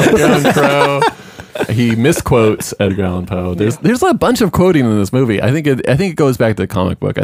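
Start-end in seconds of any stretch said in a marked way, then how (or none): 0.0-1.0 laughing. Real, not acted. Laughing repeat.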